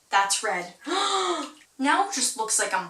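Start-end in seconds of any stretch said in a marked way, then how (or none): 0.8-1.5 high, drawn-in gasp; sharp intake of breath; startled gasp. Real, not acted. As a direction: gasp in surprise at a mistake. Reacts to red on skin.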